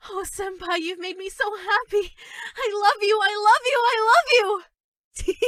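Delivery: tearful joy. Acted. Overwhelmed with joy that senpai noticed her, crying. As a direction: sob it out with growing joy.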